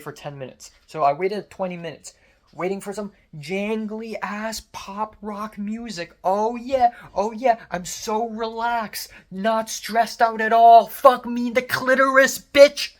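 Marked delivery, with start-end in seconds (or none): 2.5-10.9 slow, high, mocking calm; sarcastic. Sarcastic about hold music. 10.9-12.9 high, loud furious yell; angry yell. Real, not acted. Frustrated outburst after sarcasm.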